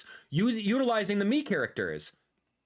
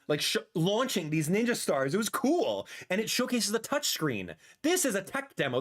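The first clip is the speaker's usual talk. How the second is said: usual speaking voice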